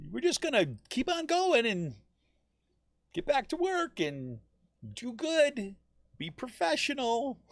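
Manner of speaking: high-pitched, sarcastic goody-goody voice; exaggerated high mock earnestness, phrase by phrase; mocking complacency. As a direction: parody someone dutifully carrying on. Parodying complacent keep-working attitude.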